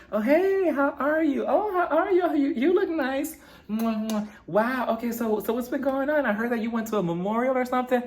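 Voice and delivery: high sing-song gushing, mocking a phony greeting; hammed-up, high-pitched fake niceness; fake friendliness. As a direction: imitate insincere socialite small talk with air kisses. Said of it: "this fake ass conversation between them".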